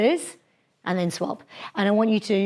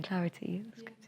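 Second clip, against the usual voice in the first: whispered; 14 dB quieter than usual. Real, not acted.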